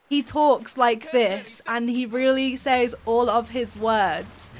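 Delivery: slow, deliberate, every word separated; slow talking. Retold or imitated. Teasing Ben about how he talks, slow deliberate imitation. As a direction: imitate a slow talker, fully sounding each word. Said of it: "Ben naturally talks quite slowly".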